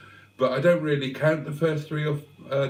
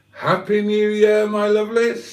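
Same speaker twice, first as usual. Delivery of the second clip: excited cheer; 8 semitones higher, 6 dB louder than usual. Real, not acted. Midnight strikes on the live stream.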